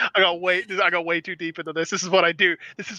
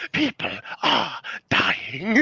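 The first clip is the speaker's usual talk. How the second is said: Hammond impression; 4 semitones lower, much slower than usual. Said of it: "He's just running next to him".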